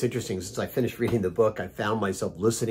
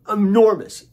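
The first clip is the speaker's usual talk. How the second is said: enormous punched much higher and louder; emphatic enormous; 9 semitones higher, 7 dB louder than usual. Real, not acted. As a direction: land hard on enormous, enormous high and loud. Emphatic recommendation of the book.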